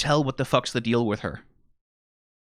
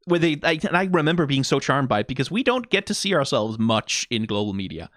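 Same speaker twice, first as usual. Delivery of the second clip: ordinary talk, nothing marked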